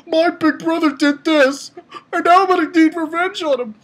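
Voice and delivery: high-pitched, hammed-up avenger voice; mocking Sasuke character. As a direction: put on a high, melodramatic mock lament. Mock quote of a Sasuke character.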